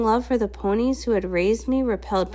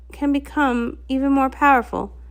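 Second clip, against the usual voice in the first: ordinary delivery, nothing marked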